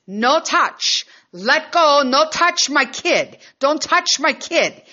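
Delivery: put-on high, loud yell to let go of her kid; screaming at stranger. Mother screaming at a stranger holding her son. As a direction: scream it, protecting your child. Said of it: "I'm screaming over the end".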